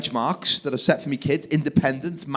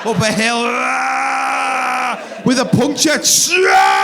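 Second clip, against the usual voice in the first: hammed-up triumphant shouts, much higher; mock cheering; 8 semitones higher, 5 dB louder, much slower than usual. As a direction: shout each obstacle like a rally cry.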